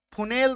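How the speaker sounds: फुनेल as "foo-nayl"; wrong vowels. Shown as a demonstration. Teacher showing what we don't say, फुनेल with "ay". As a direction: mispronounce it as फुनेल, with oo and ay. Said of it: "तो नहीं बोल रहे हैं".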